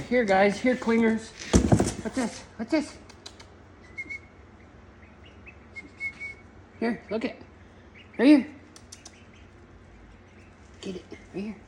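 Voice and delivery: high soft pet talk; coaxing chickens. Real, not acted. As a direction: lure the hens with a treat.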